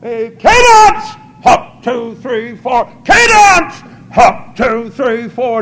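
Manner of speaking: bellowed marching call, first word held high and long; parade-ground yell, counts punched out loudly; drill cadence call. Retold or imitated. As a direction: shout cadence like a drill sergeant. Imitates troops' marching cadence call.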